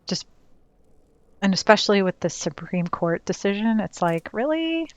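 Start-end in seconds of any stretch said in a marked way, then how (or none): 4.3-4.9 incredulous. Real, not acted. Incredulous rant about court decision.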